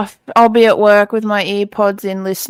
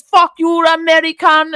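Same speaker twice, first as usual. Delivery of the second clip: put-on angry Dutch accent; 7 semitones higher than usual. S1 imitating the Dutch guy yelling at an American, mock Dutch accent. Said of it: "angry Dutch guy with this really strong accent".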